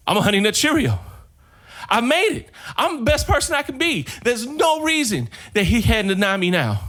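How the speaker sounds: overjoyed, laughing squeal; elated discovery. Acted. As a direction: burst out in giddy triumph. Joe sees he turned golden.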